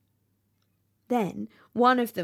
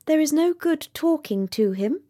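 dragonfly; 8 semitones higher than usual. A narrator's reading. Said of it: "said a dragonfly".

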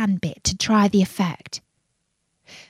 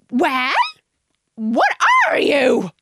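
high, loud, drawn-out challenge; demanding; 6 semitones higher, 5 dB louder, slower than usual. A narrator's reading. Pigeon interrogating Alice, demanding. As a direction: demand an answer in a squawk.

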